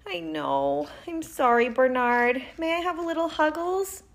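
high, small pleading voice; apologetic aunt. A narrator's reading. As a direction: meekly apologise and beg a little hug. Aunt apologising, asking for a hug.

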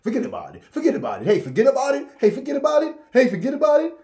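high, loud repeated yelling; overdone mock shouting. Mocking the overdone way, high loud yells. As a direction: bellow it over and over. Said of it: "it wasn't something like".

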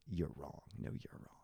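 low, soft contradiction; understated standoff. Pretend. Imagined toe-to-toe argument with someone, quiet and low. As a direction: hold firm in a hushed voice.

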